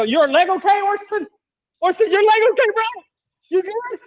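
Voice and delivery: high, hammed-up worried check-in; mock concern. Teasing Orson after the dumpster falls, mock concern. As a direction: fake concern for a partner in crime. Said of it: "I look back, I go".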